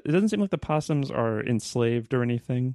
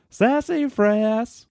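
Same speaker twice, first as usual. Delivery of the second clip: very high, slow, drawn-out cry; cried like Goofy; 11 semitones higher, 5 dB louder, much slower than usual. A narrator's reading. S0 voicing Goofy's photo cry, very high and slow. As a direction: stretch "Sassafras" way up like a cartoon dad. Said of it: "cried Goofy".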